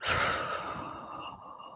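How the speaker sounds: long shaky exhale; heavy sigh. Real, not acted. Collecting herself after tears, a heavy sigh. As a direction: let out a heavy breath.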